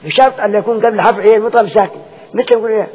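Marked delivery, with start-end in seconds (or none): none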